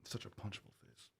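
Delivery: hushed muttered insult; whispered aside. Real, not acted. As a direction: say it under your breath.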